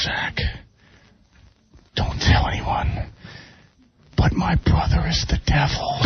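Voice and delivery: hushed, slow secretive whisper. Retold or imitated. Ozzy whispering a spooky secret to Zach, slow whisper. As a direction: breathe it like a dark secret, slowly.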